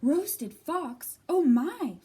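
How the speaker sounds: loud, high shocked gasp; a fox suddenly terrified; alarmed fox. Acted. Fox hearing his fate, loud and alarmed.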